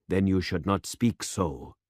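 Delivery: usual speaking voice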